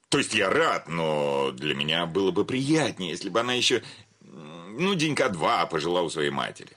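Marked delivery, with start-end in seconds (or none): none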